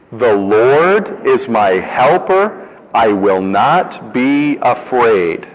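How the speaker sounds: slow, deliberate recitation, word by word; slow recitation together. Shown as a demonstration. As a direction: lead the congregation through it slowly. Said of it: "let's practice it together".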